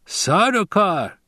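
high, drawn-out relish; gleeful. A narrator's reading. Fremen learning who his enemies were, gleeful. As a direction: savour the name with rising glee. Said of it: "appeared to be glee in his voice".

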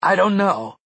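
high, loud anguished scream. A narrator's reading. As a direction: scream it out in despair. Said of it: "Mia screamed".

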